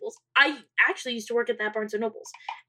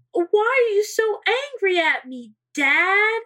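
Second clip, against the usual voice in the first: higher, louder whiny protest; mock hurt daughter; 7 semitones higher, 4 dB louder, slower than usual. Daughter faking hurt to her angry dad, high whiny. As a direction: play the wounded kid on the phone.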